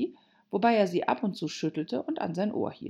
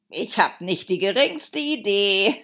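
loud, higher Hatter voice; Hatter, gleeful; 4 semitones higher, 7 dB louder than usual. Acted. Hatter with no answer for Alice, loud and amused. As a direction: admit it loudly, ending in a laugh.

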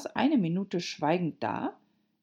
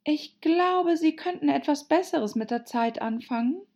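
put-on higher girl's voice, mildly weary; weary Alice; 10 semitones higher, 4 dB louder than usual. Alice fed up with riddles, high, mildly weary. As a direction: reproach with a tired touch, pitched up. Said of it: "Alice seufzte müde".

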